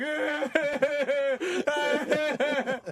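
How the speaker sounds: high-pitched imitated sobbing; hammed-up wail of someone crying; mock crying. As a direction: pretend bawling in a high voice. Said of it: "He's probably crying. He's like".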